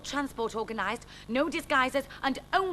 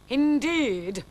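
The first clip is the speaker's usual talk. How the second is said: louder, scornful indeed; withering sarcasm; 4 semitones higher, 7 dB louder than usual. Acted. Jasper to the chatty barman, withering sarcasm. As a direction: drip contempt on the one word. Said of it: "said Jasper with withering sarcasm".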